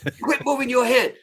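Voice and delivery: loud, high-pitched imitation of an exasperated sergeant; mock drill instructor yell. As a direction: scold a recruit like a drill instructor. Acted drill instructor scolding recruit.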